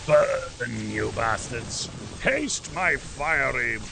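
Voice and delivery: loud, slow villain voice roaring at the horde; mock dragon threat. Gamer burning zombies, roaring as a fire-breathing villain. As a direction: roar it like a fire-breathing monster.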